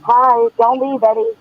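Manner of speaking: muffled voice through an earbud mic. Real, not acted. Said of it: "You're very muffled".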